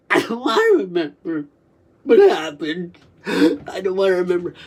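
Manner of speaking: sobbing, pleading wail; crying. Real, not acted. Resisting a memory they don't want, crying hard. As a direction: plead through heaving sobs.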